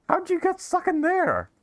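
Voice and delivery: high squeaky voice. Retold or imitated. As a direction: squeak it out mockingly. Mimics Bennett's screaming voice.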